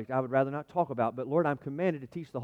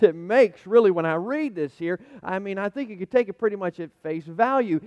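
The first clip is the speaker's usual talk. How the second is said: ordinary talk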